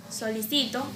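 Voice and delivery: "solicito" stressed on CI; stress on penultimate syllable. Shown as a demonstration. Teacher contrasting accent positions, stress on CI in "solicito". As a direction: lean on the CI in "solicito". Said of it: "el acento está en distintas sílabas".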